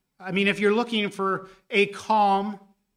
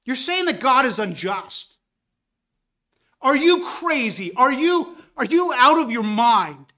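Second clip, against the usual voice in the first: raised, higher indignant rebuke; Paul's rebuke; 4 semitones higher, 6 dB louder than usual. Retold or imitated. Paul rebuking the man who calls God unjust, indignant. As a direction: scold the questioner in disbelief. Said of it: "It's as if he is saying".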